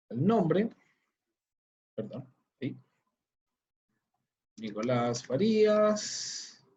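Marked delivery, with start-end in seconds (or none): 4.6-6.7 very slow, drawn-out words; slow dictation to oneself; typing aloud. Real, not acted. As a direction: stretch out each word while typing. Typing the name while saying it.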